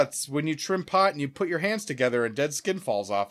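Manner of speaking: ordinary delivery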